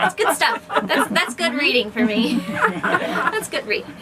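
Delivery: laughing through the words; light laughing while talking. Real, not acted. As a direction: giggle it out, amused at herself. Laughing about loving dark stuff.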